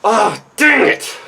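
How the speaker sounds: loud, high, drawn-out exclamation; frustrated outburst. Acted. Left behind after calling wait, frustrated cry. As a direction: let frustration burst out slowly and loudly. Frustrated at failing to stop them.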